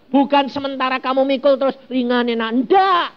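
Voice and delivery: usual speaking voice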